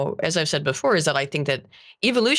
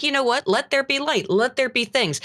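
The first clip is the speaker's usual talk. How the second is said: lightly voiced God; 8 semitones higher than usual. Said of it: "It's like".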